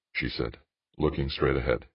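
ordinary delivery

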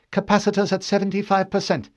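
high, put-on officer's voice; tense, high-pitched announcement; lieutenant announcing. A narrator's reading. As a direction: call out a status report. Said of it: "Lieutenant Hikawa announced".

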